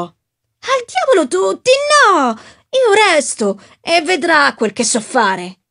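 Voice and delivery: high, slow, defiant shout; desperate shouting. Acted. The young actor defies the narrator in the café, slow high shout. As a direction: hurl it at the whole room, slowly. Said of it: "urlò".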